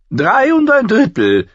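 Tyrion, hoch und gelangweilt, fast gähnend; träge die Zahl korrigieren, mit hoher Stimme; gähnend berichtigen. A narrator's reading. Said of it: "berichtigte Tyrion gähnend". (Tyrion korrigiert Mormont gähnend, hoch und gelangweilt)

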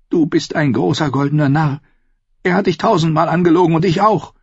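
high, contemptuous woman's voice; scornful sister. A narrator's reading. Sister calls brother a golden fool, scornful. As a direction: belittle him in a high female voice.

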